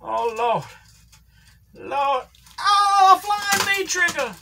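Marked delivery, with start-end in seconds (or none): 2.6-4.3 high, slow excited call. Real, not acted. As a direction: stretch out the move name. Calling a flying move.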